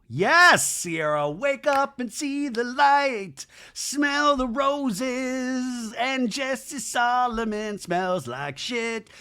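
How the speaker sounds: high, drawn-out triumphant cry; theatrical cheering. Real, not acted. Host cheers Sierra on against Jesse, theatrical cheering. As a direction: belt each phrase long and high like a rallying cry.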